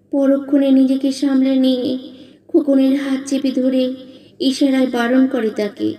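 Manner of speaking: ordinary delivery, nothing marked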